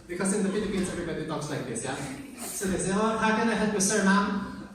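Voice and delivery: hammed-up Filipino English accent. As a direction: put on a thick Filipino accent, turning F into P. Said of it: "In the weird-ass Filipino accent".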